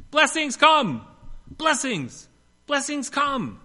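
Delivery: high, loud ecstatic cry to the sky; shouting at the top of her lungs. Retold or imitated. Woman on the beach shouting to the sky, high loud ecstatic cry. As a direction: shout it skyward like a woman possessed. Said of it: "heard a woman shouting at the top of her lungs".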